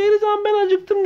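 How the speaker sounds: very high squeaky voice for the hungry toy cow. Pretend. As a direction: play the cow calling Elizan, high and pleading.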